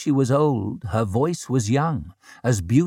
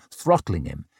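plain reading voice

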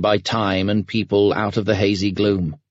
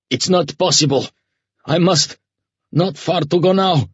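usual speaking voice

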